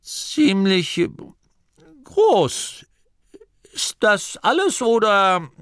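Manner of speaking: high, halting, near tears; awed fear. Acted. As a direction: voice cracking in wonder and dread.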